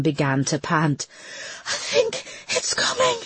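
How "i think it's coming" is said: high, crying in panic; panicked. Acted. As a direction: sob it out in sudden panic. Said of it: "eyes wild with panic".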